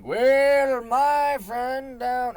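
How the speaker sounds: put-on high, loud, slow old-time orator drawl; Abraham Lincoln impression. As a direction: boom it out like a nineteenth-century statesman. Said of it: "Abraham Lincoln saying".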